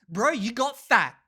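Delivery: slow and loud, no sugarcoating; blunt loud jab. Retold or imitated. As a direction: call it out loud and plain. Slapping a belly, blunt remark.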